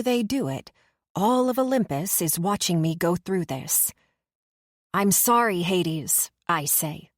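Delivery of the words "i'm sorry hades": usual speaking voice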